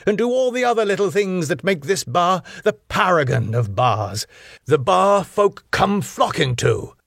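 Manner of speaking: high, swooping, weary lecture; long-suffering. A narrator's reading. Bar boss lectures his young worker, long-suffering. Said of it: "Max, long-sufferingly".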